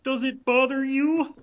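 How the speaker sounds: high Kermit the Frog voice; Kermit impression. Pyro voicing Kermit for the medic, high frog voice. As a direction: squeak it out like the Muppet frog. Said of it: "I want [name] to be Kermit now".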